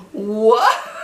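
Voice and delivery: gasping a stunned, laughing "What?"; shocked gasp. Retold or imitated. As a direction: blurt it out in disbelief, half laughing.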